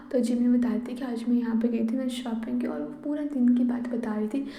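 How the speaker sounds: ordinary delivery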